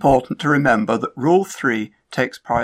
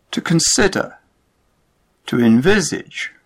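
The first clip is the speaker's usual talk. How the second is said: to conSIDer, to enVISage, second syllable stressed; verb stress demo; 4 semitones higher than usual. Shown as a demonstration. Teacher showing learners verb stress, middle syllable stressed. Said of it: "not always stressed in verbs".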